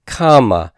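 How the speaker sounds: "comma" opening strong, falling to a light ending; stress on first syllable. Shown as a demonstration. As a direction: hit the start of "comma". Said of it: "Notice the accent is on root one".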